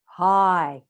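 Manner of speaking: loud, open-throated resonant "Hi"; rich deep resonance. Shown as a demonstration. Teacher demonstrates open throat breath, rich deep hi. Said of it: "how rich and resonant and deep that sound".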